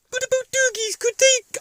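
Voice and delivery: very high, loud squeaky dog voice; a silly little dog answering back; high shrieky cartoon pup; daft dog puppet.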